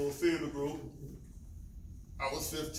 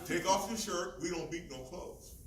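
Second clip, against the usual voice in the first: normal talking voice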